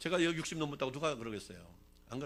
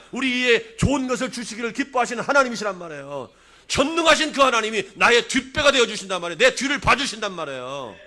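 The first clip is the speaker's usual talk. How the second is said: loud, higher proclamation of God's power; preaching at full force; 8 semitones higher, 16 dB louder than usual. Real, not acted. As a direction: thunder out each title of God. (온 힘을 다해 외치는 설교)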